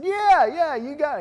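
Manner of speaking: high, loud, eager yeah yeah; eager agreement. Retold or imitated. Speaker retelling agreeing with the lieutenant governor, excited. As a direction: jump in agreeing with delight.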